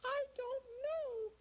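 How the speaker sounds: put-on very high, worried little girl; tiny lost girl, close to tears. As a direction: squeak it like a lost child. Lara voice.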